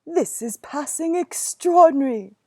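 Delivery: put-on loud amazed exclamation from the old tailor; the tailor startled by a mouse; astonished tailor. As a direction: burst out in wonder.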